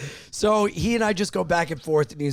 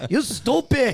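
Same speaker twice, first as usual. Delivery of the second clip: light Puerto Rican accent impression; a hint of a Puerto Rican accent; 7 semitones higher, 4 dB louder, slower than usual. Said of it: "she sounds like this".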